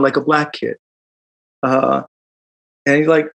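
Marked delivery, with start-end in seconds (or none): none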